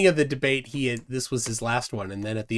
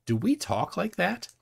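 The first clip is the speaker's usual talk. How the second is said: higher, softer questioning wife; puzzled wife; 7 semitones higher, 4 dB quieter than usual. Retold or imitated. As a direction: imitate a wife asking in doubt. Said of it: "she looks at me and goes".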